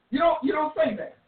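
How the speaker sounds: ordinary talk, nothing marked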